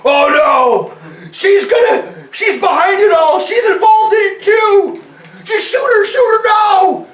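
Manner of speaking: very high, loud, frantic shouting; a paranoid man yelling to shoot the doctor; panicked paranoid man. A narrator's reading. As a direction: scream the accusation in a panic. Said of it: "another man off to the side that says".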